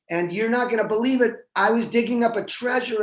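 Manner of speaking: excited. Acted.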